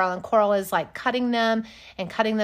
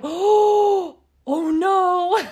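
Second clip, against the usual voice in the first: mock horrified, much higher; high dismayed oh no; 10 semitones higher, 7 dB louder, much slower than usual. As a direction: squeak out a guilty oh no. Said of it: "Coral was like".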